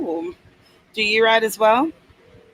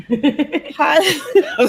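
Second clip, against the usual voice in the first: normal talking voice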